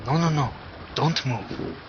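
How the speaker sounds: gentle. Acted. Healer telling wounded Lise to lie still, gentle and soft. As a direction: soothe her, keep her still. Calming the injured woman.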